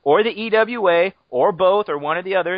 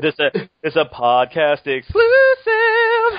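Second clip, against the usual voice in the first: mock exclusive announcement; 2 semitones higher, 6 dB louder, slower than usual. Co-host trumpeting the podcast's exclusive, hammed-up announcement. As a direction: blare it like a breaking exclusive.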